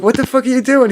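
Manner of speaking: high-pitched retold disbelief; shocked outburst.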